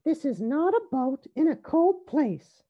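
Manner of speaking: high character voice; little rabbit Rosie. A narrator's reading. Rosie changes the game for her sisters, high voice. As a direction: pipe up high and emphatic.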